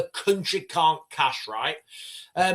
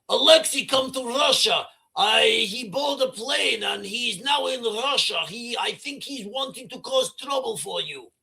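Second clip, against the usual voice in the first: lightly hammed Russian accent, pitched up, bringing bad news; Russian aide; 5 semitones higher than usual. Aide phoning Vladimir about Alexei, in a hammed-up Russian accent. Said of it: "He's like".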